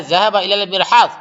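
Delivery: quick, rattled-off Arabic sentence; fast recited line. Shown as a demonstration. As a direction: rattle it off at speed. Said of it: "محمد بولتا ہے".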